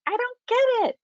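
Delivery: very high-pitched mimicking voice; mock bafflement. Readers reacting to the writer's hundredth rewrite, mock bafflement.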